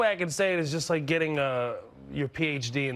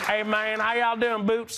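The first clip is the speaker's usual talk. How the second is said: higher-pitched put-on Texan accent; Texas accent; 6 semitones higher than usual. Comedian greeting in guessing game, mock Texan accent.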